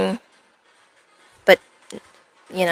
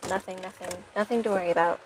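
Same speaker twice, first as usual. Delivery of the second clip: muttered reassurance; 12 dB quieter than usual. Real, not acted. Reassuring chat while flustered.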